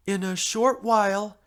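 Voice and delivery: ordinary delivery, nothing marked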